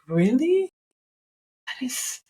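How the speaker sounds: high rising surprised question; incredulous, voice lifting sharply. Retold or imitated. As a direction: retell it with mild disbelief, pitch climbing. Retells own surprised reply on cost.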